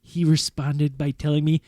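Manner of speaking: ordinary talk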